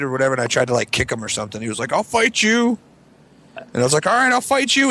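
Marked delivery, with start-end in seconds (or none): 1.9-2.8 high-pitched mock challenge; childish challenge. Imitating boy's challenge. 4.1-4.9 high-pitched mock bravado; childish challenge accepted. Acting out answer to challenge.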